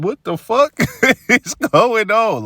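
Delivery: very high, loud, laughing cry, slowed at the end; stunned disbelief. Retold or imitated. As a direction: shriek it through a laugh. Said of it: "I'm like".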